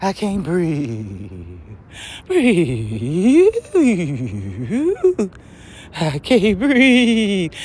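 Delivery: slow, strained mock wheeze; mock gasping complaint. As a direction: drag out the fake struggle for air. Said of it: "make that claim about you can't breathe".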